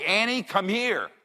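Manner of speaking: high, slow, drawn-out call; acted pet-owner call, stretched out; calling the dog. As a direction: holler high for a straying dog.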